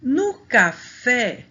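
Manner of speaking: "No café" slow and loud, hitting "fé"; "café" stretched, with a strong open "fé"; stress on final syllable. Shown as a demonstration. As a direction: punch the last syllable "fé" in "café". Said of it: "a sílaba mais forte".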